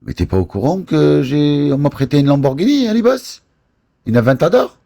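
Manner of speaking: high, fast, thrilled boast; can't hold in the bragging; excited bragging. Real, not acted. Boasting to Ali Boss about the Lamborghini, high and excited.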